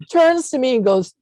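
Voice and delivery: high, loud, emotional telling; choked up. Real, not acted. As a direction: push through welling feeling. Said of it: "get choked up saying this".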